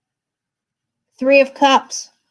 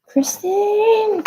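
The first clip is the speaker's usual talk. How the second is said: spooky calling; 12 semitones higher than usual. Pretend. Calling the next viewer's name.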